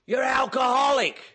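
loud, blunt accusation; shouted accusation. Retold or imitated. Man in his face at a meeting shouting the accusation. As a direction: bark it right in his face.

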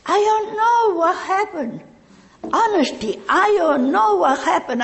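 high imitated plea of confusion; raising the voice as a helpless self on the phone; bewildered plea. Talking to her sponsor on the phone, high bewildered plea. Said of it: "He told me".

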